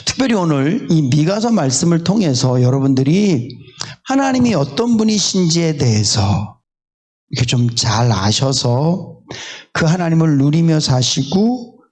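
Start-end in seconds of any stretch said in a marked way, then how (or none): none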